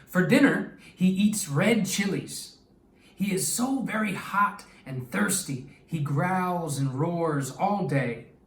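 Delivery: expressive read-aloud storytelling voice; story narration. Acted. Teacher reading a story to her class, lively and expressive. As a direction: read it like a picture book to young kids.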